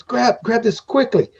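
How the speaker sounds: high, hushed urging servant voice; small servant creature urging you to take it; hurried servant. Acted. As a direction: press it into their hands in a hurry. Servant hands over gems hurriedly.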